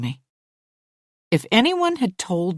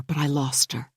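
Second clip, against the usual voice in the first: ordinary delivery, nothing marked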